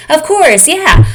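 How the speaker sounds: put-on high, eager yes of a small girl; eager child. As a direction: chirp it out eagerly.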